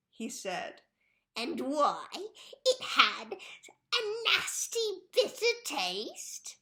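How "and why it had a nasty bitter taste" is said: slow high gloating laugh; cackling gloat. A narrator's reading. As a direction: savour it with a wicked laugh. Mrs Twit gloats.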